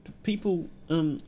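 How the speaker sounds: put-on soft, stammering little child voice, a touch of fear; a lightly voiced frightened kid stuttering through it; scared child. Reading crying child's dialogue.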